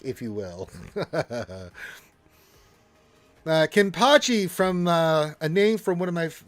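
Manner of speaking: usual speaking voice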